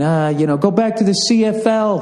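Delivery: high mocking heckle; heckling drunk fan. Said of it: "wanted to get in and all the shit talking".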